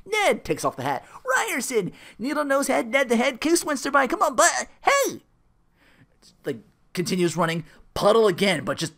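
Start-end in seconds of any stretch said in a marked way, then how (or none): 1.2-5.2 high, loud, hammed-up pest voice; Ned. Ned pestering Phil with his nicknames, high and excited. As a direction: rattle off nicknames with pushy excitement, pitched up.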